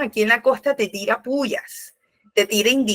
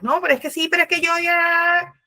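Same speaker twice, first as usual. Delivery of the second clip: higher, pushy protest; rising upset; 8 semitones higher, faster than usual. Acted. The other person getting worked up, higher heated protest. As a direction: voice climbing in frustration. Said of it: "ahí te está subiendo".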